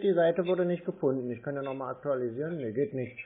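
ordinary talk, nothing marked

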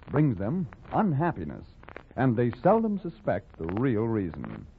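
ordinary talk

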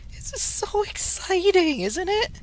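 high squeaky fake excitement; squeaky overexcited show voice; mock excited squeal. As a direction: ham up the thrill for the viewers.

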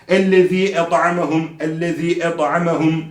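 "et'amehum" with a clear throat ع; pronounced ayın. Shown as a demonstration. Quran teacher drilling the correction, ayn articulated. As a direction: squeeze the ayn after the t in "et'amehum". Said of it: "ayını mutlaka çıkartmamız lazım".

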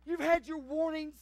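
loud, high pleading altar call; preaching at full force. Real, not acted. Pastor pleading with the congregation, preaching hard. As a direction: plead and shout to the pews.

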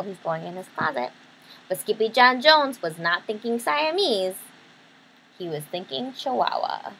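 ordinary delivery, nothing marked

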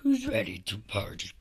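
very low, deep party voice; rowdy party animal. Pretend. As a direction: drop into a deep monster voice. Last take in deep voice.

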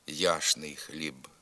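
яшний with ш for чн, said slowly; яшний, not ячний; spoken pronunciation. Shown as a demonstration. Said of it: "а вимовляємо".

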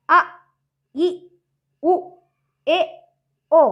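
அ, இ, உ, ஏ, ஓ each cut short; brief separate vowels with gaps between. Shown as a demonstration. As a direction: recite அ, இ, உ, ஏ, ஓ as quick short sounds. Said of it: "குறுகிய ஓசை உடையதால".

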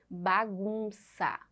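"Bagunça" drawn out very slowly, syllable by syllable; careful slow pronunciation. Shown as a demonstration. Teacher helping children hear the stressed syllable, "Bagunça" slowly drawn out. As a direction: stretch each syllable of "Bagunça" so it can be heard. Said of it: "Vamos tentar ouvir direitinho ou até mesmo pronunciarmos".

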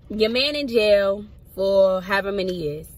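slow, drawn-out, emphatic delivery; out-of-character phrasing. Real, not acted. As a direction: stretch it out slowly, word by word. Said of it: "why I just said it like that".

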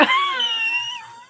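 high, drawn-out squeal of laughter; high shrieking laugh. Real, not acted. Woman reacting to spicy jerky, high laugh. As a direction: let out a long high laugh.

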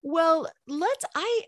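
ordinary talk, nothing marked

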